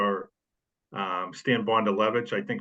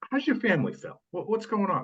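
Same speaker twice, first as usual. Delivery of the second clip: friendly change of subject; 9 semitones higher than usual. Retold or imitated. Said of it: "turn it around and say".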